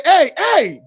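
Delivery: a loud, retold hey, hey; calling out. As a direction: call out to get their attention. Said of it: "So he cried".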